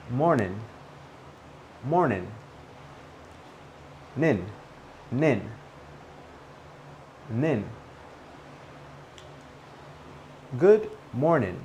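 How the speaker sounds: MORNING said "mor-nin", no G sound; MORNING ending in "nin". Shown as a demonstration. Teacher drilling the first way, MORNING as "mor-nin". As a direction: end MORNING on a plain N, "nin". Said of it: "you can say it in two different ways".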